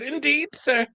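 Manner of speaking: high-pitched imitated Scottish accent; thick Scottish accent. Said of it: "she had a thick accent".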